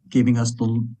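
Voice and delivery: normal talking voice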